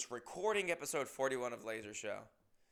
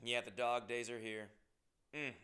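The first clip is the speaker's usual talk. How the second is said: usual speaking voice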